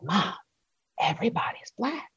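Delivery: put-on whispered, high child's secret; awed whisper. A child whispering to her mom after her first school day. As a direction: breathe it like a shocked kid.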